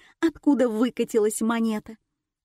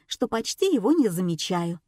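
usual speaking voice

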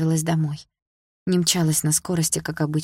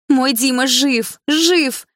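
higher, louder, slow elated cry; joyful inner shout; 8 semitones higher, 6 dB louder, much slower than usual. A narrator's reading. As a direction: burst out with joy. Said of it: "Хотелось кричать во весь голос". (восторженный крик радости)

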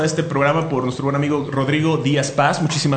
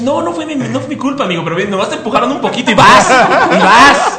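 high, fast mimicking of a friend; high mock Carmen voice; 8 semitones higher, 7 dB louder than usual. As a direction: squeak it out teasingly. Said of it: "Carmen dice".

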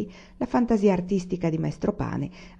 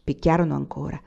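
plain reading voice

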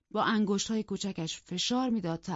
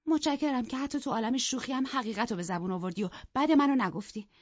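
higher, quickened wounded pride; hurt indignation; 6 semitones higher, faster than usual. Acted. Isabella appealing to Heathcliff, hurt and rushed. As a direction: plead with stiff dignity.

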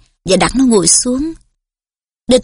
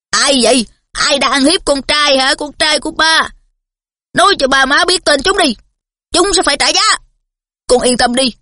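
blustering father; 4 semitones higher than usual. A narrator's reading. Father demanding who hurt son.

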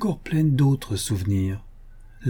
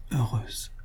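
much softer, barely voiced "Heureuse"; faint dying murmur; 8 dB quieter than usual. A narrator's reading. Dying wife to her husband, a much softer faint murmur. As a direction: breathe "Heureuse" out faintly, near the end of strength. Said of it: "dit-elle deux fois".

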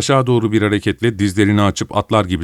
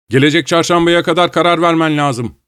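raised, much higher voice; loud demand; 8 semitones higher, 3 dB louder than usual. A narrator's reading. Joe pressing his sister to decide by Wednesday, loudly. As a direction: raise your voice to press the point. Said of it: "dedi Joe yüksek sesle".

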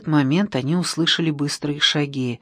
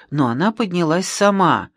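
ordinary delivery

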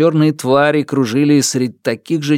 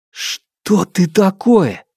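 higher, slow frightened question; frightened demand; 7 semitones higher, much slower than usual. Acted. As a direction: ask it slow and high, afraid. Hero confronts the darkness.

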